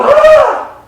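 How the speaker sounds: loud, high startled "Ah!"; alarmed shout. Shown as a demonstration. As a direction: yell to stop the kid at the stove. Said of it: "You're gonna go".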